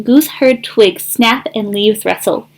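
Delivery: usual speaking voice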